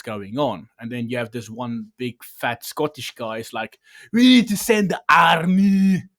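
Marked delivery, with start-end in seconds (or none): 4.1-6.0 high, loud, barking Schwarzenegger-style general; Arnold voice. Retold or imitated. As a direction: bellow orders like a movie-star Arnold. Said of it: "the reason I'm doing Arnold instead of a Scottish, uh, accent".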